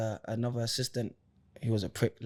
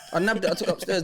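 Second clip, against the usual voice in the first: higher and brighter, through a laugh; gleeful as you repeat the theft; laughing retelling; 7 semitones higher, faster than usual. Real, not acted. To friends about taking the snacks, a laughing higher retelling.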